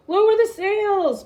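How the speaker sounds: slow, loud, high order in a storm; shouting. A narrator's reading. Braidbeard shouts to the crew in the storm, loud and high. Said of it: "Braidbeard shouted".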